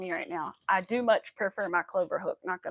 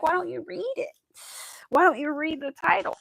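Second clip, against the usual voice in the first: high, louder, incredulous questioning; exasperated retort; 8 semitones higher, 6 dB louder than usual. Retold or imitated. Answering chat viewers who ignore the silent-live title, exasperated. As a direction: throw the question back, fed up. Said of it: "You're like".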